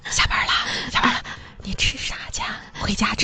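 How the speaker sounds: barely audible whispered small talk; exaggerated whisper. Shown as a demonstration. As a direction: whisper it as softly as possible, as a demo of hushed elevator chat. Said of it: "那也不必这么小声吧".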